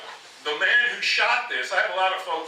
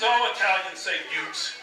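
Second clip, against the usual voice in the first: normal talking voice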